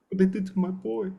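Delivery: high, heartbroken mob boss; pained mafia don impression; Godfather. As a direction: grieve softly in a put-on don's voice. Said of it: "heartbreaking scene when he sees Sonny".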